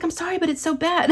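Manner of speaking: high, whiny mock child voice; whiny kid. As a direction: whine it like a kid who can't stop. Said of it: "She's like".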